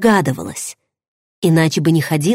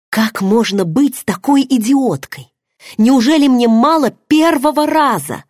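rising higher and louder in bitter self-blame; angry self-reproach; 7 semitones higher, 3 dB louder than usual. A narrator's reading. Heroine berating herself alone in the shower, bitter and rising. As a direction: scold yourself, building to a cry. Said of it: "корила я себя".

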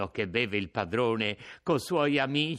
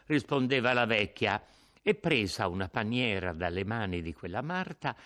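ordinary delivery, nothing marked

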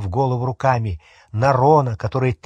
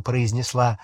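ordinary delivery, nothing marked

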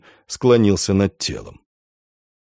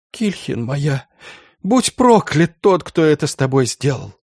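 grieving curse, rising high then falling; sobbing; 4 semitones higher than usual. A narrator's reading. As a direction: start tender, break into a wailed curse. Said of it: "рыдая".

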